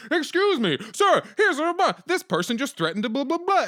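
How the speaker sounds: high-pitched mock outrage, loud and indignant; extremely outraged. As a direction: put on a show of outrage for security. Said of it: "I get to act extremely outraged".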